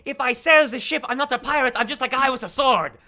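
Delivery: loud, high-pitched argument; heated protest. Acted. Duke refusing to sell his ship, loud heated protest.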